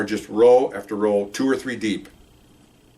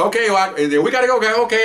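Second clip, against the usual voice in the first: high-pitched, louder brush-off; hasty goodbye; 11 semitones higher, 4 dB louder, faster than usual. Retold or imitated. Officers taking leave of the doctors, hasty. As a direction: act out an abrupt exit line. Hurried awkward exit after answer.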